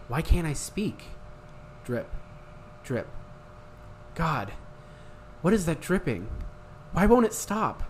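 confused, frightened inner voice; dramatic story reading. Acted. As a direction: read it like an eerie audiobook. Dramatic reading of book excerpt.

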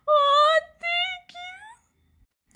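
very high, tearful squeak, loud then tiny; emotional thanks. Retold or imitated. Daughter re-enacting thanks to her dad, tearfully emotional. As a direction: squeal it through mock-weepy gratitude. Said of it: "that made me so emotional".

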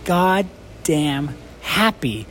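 slow, drawn-out mock complaint; mock grumpy coworker. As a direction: drag the words out like a fed-up cook teasing a happy coworker. Cook complaining in retold story.

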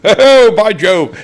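very high, loud, slow exclamation. Retold or imitated. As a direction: crow it out in a high, booming voice. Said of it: "then you got [name] came on".

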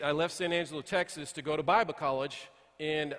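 usual speaking voice

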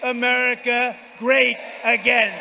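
very loud, high closing shout; roaring rallying speech. Real, not acted. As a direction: roar the finish over cheers. Rally finale and thanks.

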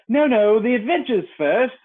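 loud, high, impatient insistence. A narrator's reading. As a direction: cut in impatiently. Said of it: "in an impatient tone".

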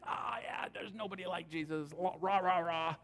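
soft, breathy mock cheer; the preacher mocks the claim as hollow cheering for Jesus; mock cheerleading. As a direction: wave it off like an empty rah-rah chant. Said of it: "they're kind of hyperbole".

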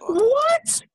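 high shocked cry of disbelief; shocked what. Real, not acted. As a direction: yelp it in disbelief. Shocked at Justice League pick.

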